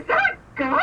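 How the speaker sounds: high-pitched startled question; mock alarm. Heather seeing JT's gun, mock alarm. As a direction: squeal it as a mock-shocked question.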